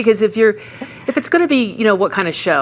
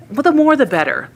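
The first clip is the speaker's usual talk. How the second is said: higher-pitched, sarcastic sign-off; 7 semitones higher than usual. Real, not acted. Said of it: "Love the sarcasm".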